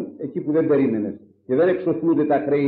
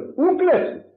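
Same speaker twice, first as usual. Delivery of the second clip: much higher, ringing commandment; proclaiming; 13 semitones higher, slower than usual. Acted. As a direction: proclaim it from above. Quoting the commandment, pitch leap.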